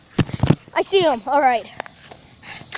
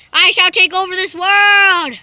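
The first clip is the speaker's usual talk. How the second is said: slow, loud, higher would-be ruler; villain proclamation; 4 semitones higher, 7 dB louder, slower than usual. Pretend. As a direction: declare world domination, hammed up. Villain cat proclaims its plan.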